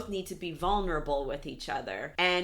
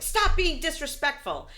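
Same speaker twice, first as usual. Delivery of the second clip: mock scolding mom; 10 semitones higher, 5 dB louder than usual. Mom scolding in retold fight.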